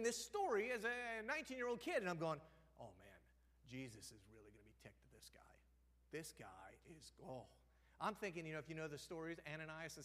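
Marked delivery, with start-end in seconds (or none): 2.8-7.5 near-whisper, much softer, trailing into breath; dropping to a whispered aside; hushed inner thought. Retold or imitated. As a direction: mutter it to yourself in disbelief. Young reader's shocked inner thought.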